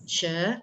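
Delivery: SHE rising from middle pitch; second tone. Shown as a demonstration. Teacher modelling Mandarin tones for students, second tone. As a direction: lift she upward like a short question. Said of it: "Tone kedua".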